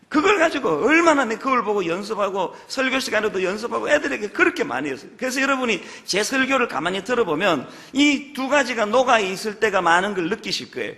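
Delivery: ordinary talk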